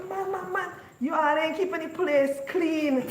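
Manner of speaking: high-pitched scolding mama; scolding mother. Retold or imitated. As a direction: fuss at the kids for the mess. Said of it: "She go".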